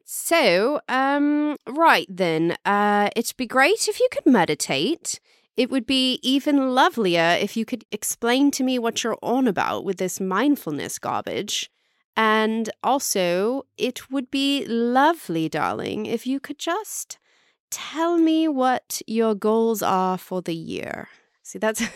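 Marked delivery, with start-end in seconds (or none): 0.0-16.1 put-on British accent, higher pitch. Shown as a demonstration. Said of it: "Let me just try it". 16.1-21.1 higher-pitched put-on British accent. Shown as a demonstration. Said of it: "I do a really good British accent".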